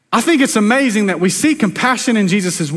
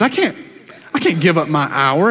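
usual speaking voice

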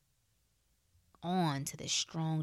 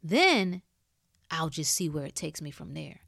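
normal talking voice

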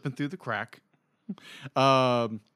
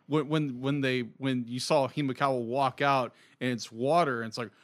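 normal talking voice

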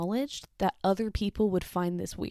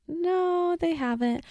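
much higher, a bit louder; high startled reply; 10 semitones higher, 3 dB louder, slower than usual. Retold or imitated. Cashier answering the hat man, high and startled. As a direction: pipe it up high, caught off guard.

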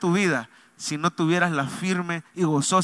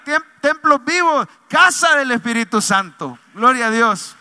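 louder, higher fervent preaching; preaching at full force; 5 semitones higher, 6 dB louder than usual. Acted. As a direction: build it up like a revival climax. Preaching climax about living temples.

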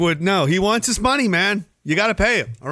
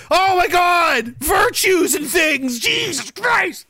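mock outraged shout; 10 semitones higher, slower than usual. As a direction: shriek it like a scandalised prude. Mock outrage at good guy.

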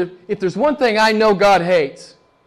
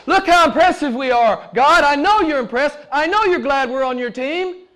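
lightly mocking boast, higher pitch; self-impressed religious boasters; 10 semitones higher than usual. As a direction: imitate proud men showing off to God. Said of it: "These guys are saying".

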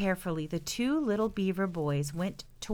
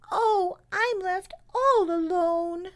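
slow, high, tiny wail; baby beaver, forlorn; 11 semitones higher, slower than usual. Acted. Baby beaver telling the brothers she is alone, forlorn wail. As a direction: lament slowly in a little high voice. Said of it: "said the baby".

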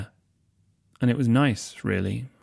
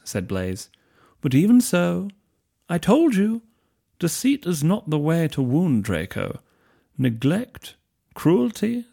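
higher, slow, lingering voice; silky schemer; 7 semitones higher, slower than usual. Acted. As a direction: purr it like a smooth manipulator. Blaise's smooth affected voice.

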